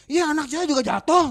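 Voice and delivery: loud, high-pitched mock retort; angry mother. Mother refusing to pay for damage, retold angry retort. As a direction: argue back like an indignant mother. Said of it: "orang tuanya datang marah-marah".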